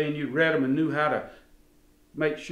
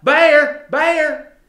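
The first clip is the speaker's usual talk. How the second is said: loud, high false-alarm cry; hollered alarm; 11 semitones higher, 10 dB louder than usual. Retold or imitated. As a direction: holler it out like a kid raising the alarm. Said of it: "she hollered out".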